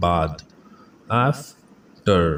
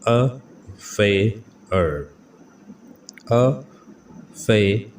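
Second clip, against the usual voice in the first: Advertising stretched out very slowly; teacher slowly modelling the word for students; slow syllable by syllable; much slower than usual. Shown as a demonstration. Slow, careful pronunciation drill. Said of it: "pronunciation drill".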